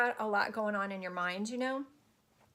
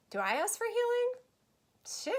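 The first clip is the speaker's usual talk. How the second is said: mock disbelief; 13 semitones higher, slower than usual. Real, not acted. Mock-incredulous repeat of question.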